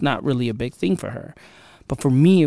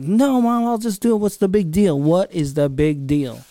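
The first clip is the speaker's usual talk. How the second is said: acted-out teenage arguing with mom; exasperated protest; 5 semitones higher than usual.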